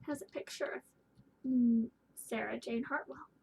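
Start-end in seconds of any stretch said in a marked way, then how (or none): none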